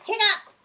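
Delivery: sudden hiccup; loud hiccup. Acted. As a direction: burst out a single hiccup. One more hiccup.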